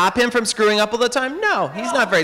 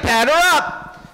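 slow, drawn-out, higher tough-guy line; cocky hero quip; 6 semitones higher, much slower than usual. Retold or imitated. Heracles faces the Hydra, slow and cocky. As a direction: savor it like a slugger stepping up.

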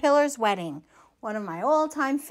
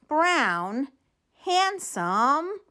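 plain reading voice

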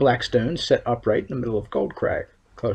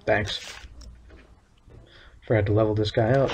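ordinary talk, nothing marked

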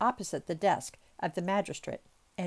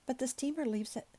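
higher-pitched, mildly nervous objection; 6 semitones higher than usual. A narrator's reading. Servant worried about missing the noon steamer, mildly nervous. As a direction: raise the pitch, a touch anxious. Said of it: "observed Passepartout nervously".

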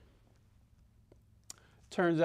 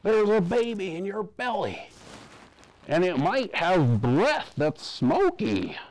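doctor's voice; 9 semitones higher, 4 dB louder than usual. A narrator's reading. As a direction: draw it out like a solemn doctor. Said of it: "the doctor said".

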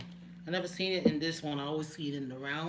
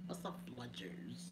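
puzzled whisper; 4 semitones lower, 12 dB quieter than usual. Real, not acted. Puzzling over misspelled brand.

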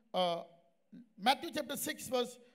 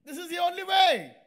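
loud, higher preaching voice; emphatic insistence; 5 semitones higher, 8 dB louder than usual. Retold or imitated. Preacher pressing a woman to forgive, insistent. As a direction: drive it home loudly.

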